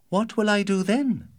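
put-on high, anxious girl; young girl voice. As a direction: ask it as a worried daughter. Daughter asks, much higher.

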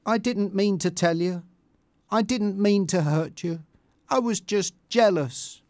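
regretful and imploring; remorseful apology. A narrator's reading. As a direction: apologise earnestly. Tanya apologises to crying Bianca.

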